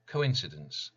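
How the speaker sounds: plain reading voice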